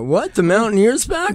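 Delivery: high-pitched, incredulous double take; mock surprise. Imagined driver hears the paper is back, mock surprise. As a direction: act out a startled driver's disbelief, voice jumping up.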